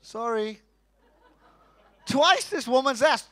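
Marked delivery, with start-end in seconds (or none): 0.0-0.6 softer, chastened sorry; sheepish sorry. Retold or imitated. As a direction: mumble a meek apology. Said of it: "when I say".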